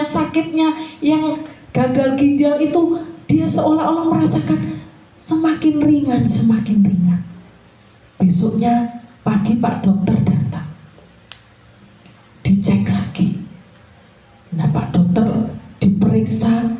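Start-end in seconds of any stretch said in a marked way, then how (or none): none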